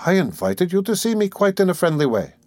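high, lilting false friendliness; lightly voiced Porfiry, sly. Examining lawyer reassuring his suspect, slyly friendly. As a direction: soothe him with gloating sweetness.

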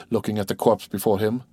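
ordinary delivery, nothing marked

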